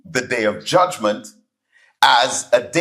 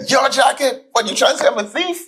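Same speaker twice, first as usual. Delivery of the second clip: much higher, fast and mock-offended; cheeky denial; 12 semitones higher, faster than usual. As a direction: act insulted while brazenly denying.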